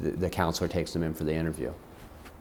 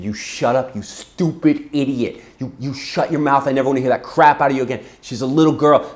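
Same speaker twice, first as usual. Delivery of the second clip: loud, raised-pitch telling-off; furious; 6 semitones higher, 9 dB louder than usual. Retold or imitated. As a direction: tear into the mother, re-enacting your fury. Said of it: "I'm so furious".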